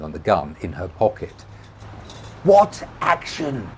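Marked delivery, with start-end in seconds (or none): 2.4-3.7 high, slow, drawn-out yell. A narrator's reading. Rebecca demanding an answer from Tommy, yelling. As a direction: yell each word slowly. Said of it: "she yelled".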